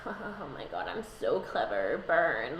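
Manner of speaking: lightly voiced smug king gloating to himself; smug gloating [name]. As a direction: purr it like a villain pleased with himself. [name] gloats over his nickname.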